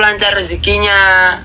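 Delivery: ordinary delivery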